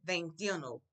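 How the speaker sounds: Veintiuno run together quickly; Veintiuno, one quick word. Shown as a demonstration. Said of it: "I'll say it quickly".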